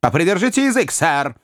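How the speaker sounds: sharp order to be silent; commanding. A narrator's reading. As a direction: bark it like a stern magistrate. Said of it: "повелительно сказал судья".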